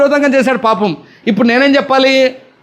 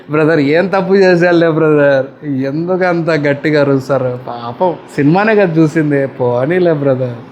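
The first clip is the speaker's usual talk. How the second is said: excusing bystander; 6 semitones lower than usual. Retold or imitated. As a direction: brush it off like a friend who takes sides. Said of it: "పక్కనుండి".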